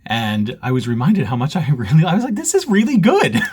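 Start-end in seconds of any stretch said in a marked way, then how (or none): 2.3-3.4 high, louder burst of delight; retold delight. Host reliving seeing the finished stitching, high excited delight. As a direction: pitch it way up, pleased with your own work. Retells own delighted reaction.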